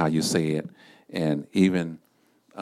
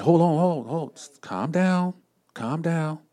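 higher, soothing hold-on voice; pitched-up gentle calm down; calming a panicked caller; 9 semitones higher than usual. Acted. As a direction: settle a frantic family member down. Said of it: "family member call you panicking and freaking out, say".

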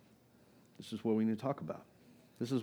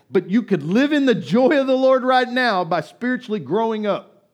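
loud, high, urgent preaching; preaching at full force; 9 semitones higher, 13 dB louder than usual. Acted. Pastor exhorts congregation loudly to spiritual maturity. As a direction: exhort the church to grow up.

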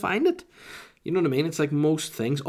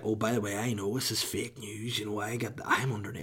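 hushed conspiracy voice; 6 dB quieter than usual. Retold or imitated. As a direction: confide it like a secret insider. Mocking a conspiracy theorist.

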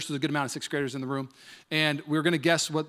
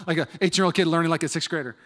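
ordinary delivery

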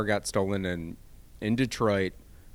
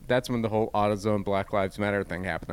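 usual speaking voice

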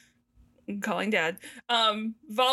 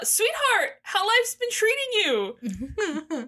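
much higher, drawn-out mock sweetheart greeting; hammed-up fatherly greeting; 9 semitones higher, much slower than usual. Jasek greeting his daughter Vala, hammed-up and high. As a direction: play the father, pitched high and slow.

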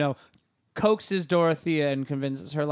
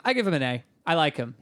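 usual speaking voice

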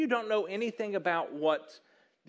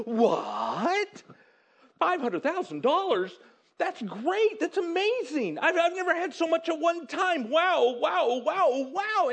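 high-pitched amazed delight, climbing from disbelief; so excited; 9 semitones higher than usual. Acted. Employee just handed half a million by the boss, so excited. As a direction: act overjoyed at a windfall. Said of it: "you're so excited".

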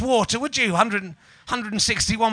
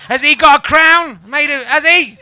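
mocking jeer; 6 semitones higher, 11 dB louder than usual. Mocking jeer at rival comic.

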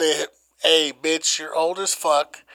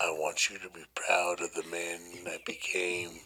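whispering; 10 semitones lower, 10 dB quieter than usual. Pretend. Mock farewell to dads, whispered.

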